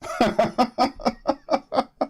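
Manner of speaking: high scoffing laugh; real mocking laugh. Real, not acted. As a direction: laugh off the hype. Laughs at Cleveland hype.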